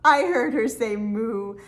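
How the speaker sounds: ordinary talk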